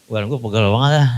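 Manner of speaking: put-on a low, tired grumble of an old man; weary old saint. Preacher mimics Kong Daud grumbling, low and weary. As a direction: complain of aching bones, low and worn out. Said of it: "Kalau Kong Daud begitu lagunya".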